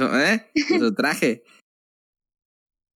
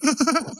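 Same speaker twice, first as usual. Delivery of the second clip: laugh; 12 semitones higher than usual. Real, not acted. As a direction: burst of high laughter. Laughing at the joke.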